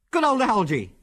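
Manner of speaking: high-pitched joyful call; elated, high-pitched cheer; gleeful shout. A narrator's reading. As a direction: shout it with glee. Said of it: "he shouted gleefully".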